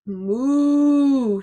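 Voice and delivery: long, sustained mooing; cow's moo. A narrator's reading. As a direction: moo like a cow, drawing out the vowel. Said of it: "said the cow".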